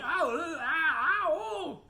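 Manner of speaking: high-pitched drawn-out ows; yelling in pain. Acted. Something yelling under a pile of flies, high pained wailing. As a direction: wail "ow, ooh" way up high. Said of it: "jumping up and down and yelling".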